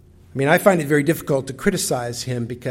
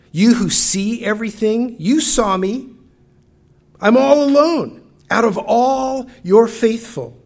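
raised, loud, pleading cry of despair to God; anguished Elijah; 4 semitones higher, 5 dB louder than usual. Acted. Elijah cries out to God in his despair, anguished and raised. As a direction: pour out abandonment and exhausted fear to God. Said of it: "this is the internal conversation in Elijah's mind".

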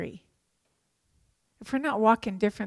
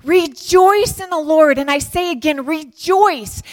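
exultant, full-voiced call to rejoice; emphatic rejoicing; 6 semitones higher, 9 dB louder than usual. Shown as a demonstration. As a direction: proclaim it with all your heart. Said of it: "No, Paul wasn't saying it that way".

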